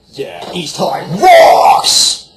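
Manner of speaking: loud, high, slow drawn-out cheer; a dopey character shouting a school cheer. Acted. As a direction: yell it like a pep rally.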